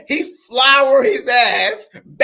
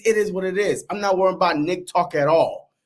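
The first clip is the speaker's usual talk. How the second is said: ordinary talk, nothing marked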